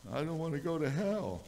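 put-on high, small voice of a scared boy; pleading child. Scared son repeats his fear to his dad, high child's plea. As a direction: plead it up high like a little kid.

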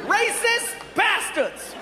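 high, slow, drawn-out outburst; acted outraged yell. Man yells from inside his hotel room, outraged. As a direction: wail it out, imitating an outraged man. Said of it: "we heard".

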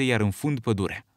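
usual speaking voice

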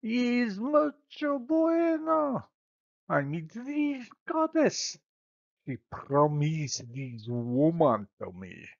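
put-on high, slow stage Spanish accent; hammy Spanish lover in a Spanish accent. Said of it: "announcing his good fortune".

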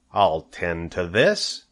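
a hint of a sly, slow rat voice; lightly relishing it, drawn out; chuckling rat. A narrator's reading. Templeton eyes Wilbur's tail, lightly chuckling scheme. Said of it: "he chuckled".

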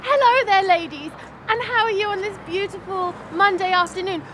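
high, put-on posh telephone voice; posh phone voice. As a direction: sound terribly proper on the phone. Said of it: "that's my posh phone voice".

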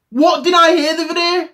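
lifted, put-on voice for the question. Teasing the viewers with a put-on voice. Repeated rhetorical question, first take.

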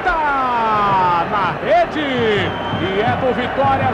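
very slow drawn-out goal cry; stretched goal call. Real, not acted. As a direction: drag the words out for the goal.

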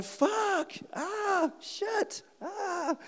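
very high, thin mock wails; imitated pain cries. Wounded man in hospital, high imitated cries of pain. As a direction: squeal the curses in acted agony.

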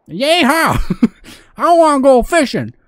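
high, loud southern whoop; southern accent. Pretend. Gamer whooping about going fishing, loud southern accent. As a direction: holler it like an excited southerner. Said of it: "keep the southern accent".

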